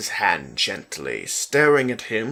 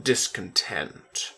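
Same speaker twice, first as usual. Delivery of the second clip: plain reading voice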